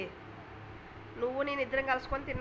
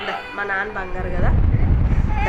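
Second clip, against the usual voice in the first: loud coaxing; 10 dB louder than usual. Real, not acted. Mother loudly coaxing child. (భయపడ్డ బిడ్డను గట్టిగా ముద్దుగా పిలుస్తూ)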